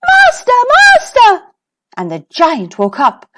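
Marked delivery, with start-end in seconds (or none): 0.1-1.4 much higher, loud cry for help; a magic harp shrieking for its master. A narrator's reading. Stolen harp calling out to the giant: high loud cry. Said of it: "the harp cried".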